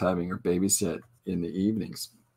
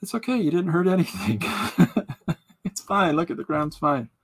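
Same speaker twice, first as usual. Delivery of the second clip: mock soothing; 9 semitones higher than usual. Comforting the ground jokingly.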